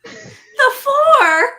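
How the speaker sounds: high laughing squeal. Real, not acted. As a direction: shriek it through laughter. Group laughing at story.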